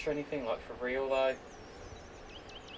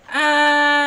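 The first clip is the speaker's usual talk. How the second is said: high squeal; 13 semitones higher, 14 dB louder than usual. Pretend. High squeal after singing.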